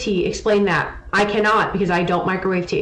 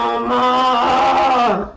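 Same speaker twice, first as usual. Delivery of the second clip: imitated baby grunt; 4 semitones higher, 4 dB louder than usual. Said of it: "she goes".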